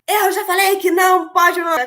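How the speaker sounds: loud, high scolding; a sharp, loud reprimand; scolding parent. Shown as a demonstration. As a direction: snap at the child like an exasperated parent. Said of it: "pro seu filho e fala assim".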